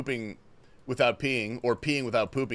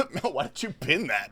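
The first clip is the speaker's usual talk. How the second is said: ordinary talk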